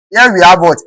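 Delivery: ordinary delivery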